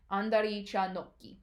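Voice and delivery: 안다리 차 놓기 fast, 놓 stopped short; quick 안다리 차 놓기 with a held stop before 기; teacher demo of 안다리 차 놓기; Korean kick name. Shown as a demonstration. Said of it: "you actually add a little bit of a stop".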